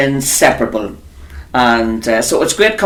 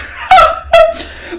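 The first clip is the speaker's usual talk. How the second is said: high laugh. Real, not acted. Laughing at club memory.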